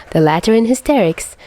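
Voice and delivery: ordinary delivery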